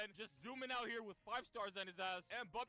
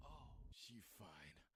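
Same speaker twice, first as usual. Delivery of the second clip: much lower, softer, slow ogling; deep drawn-out mock leer; lusty admiring voice; 10 semitones lower, 7 dB quieter, much slower than usual. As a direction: growl it low and slow. Admiring Rem.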